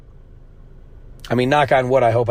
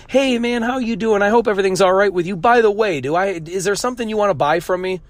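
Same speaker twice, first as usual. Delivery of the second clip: much higher, sugary mock greeting turning into a pitch; mock fake-friendly seller; 7 semitones higher than usual. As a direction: lay the fake friendliness on thick, then slip in the sale. Mocks seller latching onto people.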